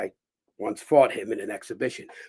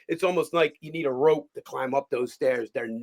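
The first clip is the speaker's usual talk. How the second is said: ordinary talk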